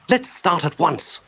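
high, drawn-out eager cry. Acted. Mole keen to set off downriver, eager. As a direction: urge it with impatient delight.

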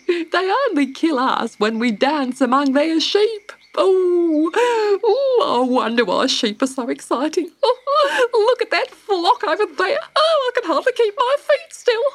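high, loud, giggly squeal; giddy emu, overexcited. A narrator's reading. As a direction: get carried away with glee.

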